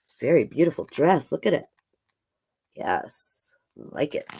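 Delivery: usual speaking voice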